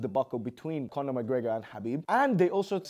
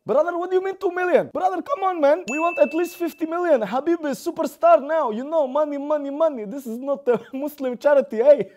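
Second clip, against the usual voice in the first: much higher, loud, pushy manager voice; manager impression; 10 semitones higher, 8 dB louder than usual. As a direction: squeal the demand like a greedy agent. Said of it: "Ali Abdelaziz, responded and said".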